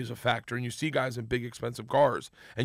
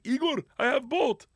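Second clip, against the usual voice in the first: 1 buddy calling out; 11 semitones higher than usual. Retold or imitated. Buddy announcing he has a boat.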